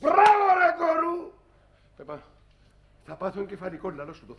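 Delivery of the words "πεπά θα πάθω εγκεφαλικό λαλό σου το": muttering under his breath, much softer, "θα πάθω εγκεφαλικό" sunk low; muttered despair. Acted. Father mutters to his wife he'll have a stroke, much softer and lower. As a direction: sink into an exhausted mutter.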